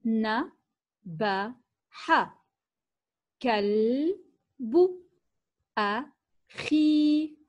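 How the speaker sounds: very slow, each word نبح كلب أخي set apart with long pauses. Shown as a demonstration. Teacher reading to children, very slow and word by word. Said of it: "لنقرأ معًا هذه الجمل".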